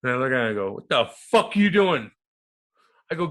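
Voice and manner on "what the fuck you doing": high, loud indignant yell; retold yell. Guest retells confronting a player, yelling. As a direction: holler it at him as he passes. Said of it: "but I just yelled at him".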